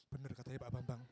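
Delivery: low-pitched, private confirmation; low aside. Acted. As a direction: say it low, as if to oneself. Acts guest confirming hotel's scent.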